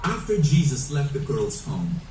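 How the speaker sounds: ordinary delivery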